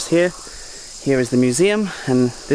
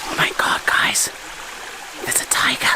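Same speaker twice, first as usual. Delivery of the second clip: hushed, unvoiced whisper of fake alarm; mock scared whisper; 4 dB quieter than usual. Joking to viewers about a tiger in the jungle, mock scared whisper. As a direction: whisper it like you've spotted a tiger. Pretend tiger sighting, admitted joke.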